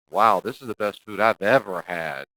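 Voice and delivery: loud, slow, overdone sarcastic wow; sarcastic praise. Shown as a demonstration. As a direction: gush falsely about the food, slowly. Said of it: "It's just a joke, but you meant it".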